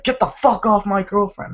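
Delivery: high-pitched retold outburst; mock angry yell. Retold film confrontation over girlfriend.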